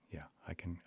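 whispered, barely voiced; hushed whisper. Veteran telling the therapist he remembers, whispered. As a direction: voice sinking to a shaky whisper. Veteran recalling trauma after crying.

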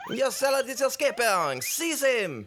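high, loud alarm at the fleeing dish; alarmed shout. Acted. Chef shouting to the hosts that his dish is running off, loud and alarmed. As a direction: yell it in a panic. Chef yells as pet flees.

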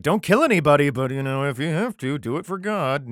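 a loud, hammed-up preacher's voice; sling it out like a half-joking decree; mock commandment. Mock paraphrase of the scripture.

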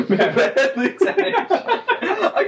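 cracking up through words; laughing. Real, not acted. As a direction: laugh while speaking, high. Laughing at the bit.

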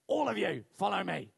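loud, stern schoolteacher order; imitated teacher shouting orders; teacher's command. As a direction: call the culprits out loudly. Teacher orders boys to follow.

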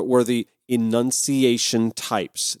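ordinary delivery

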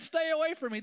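high, loud call into the woods. Retold or imitated. Hiker calls out to scare off a bear, loud and high. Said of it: "you're like".

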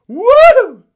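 very high, piercing "woo" shriek; loud shout. Acted. Demon leaving the man with a loud high shriek. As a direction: let out a sudden high howling shout. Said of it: "with a loud shout".

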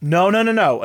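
loud, high, quick string of refusals; acted urgent protest. Retold Doctor stopping a caller hanging up, urgent protest.

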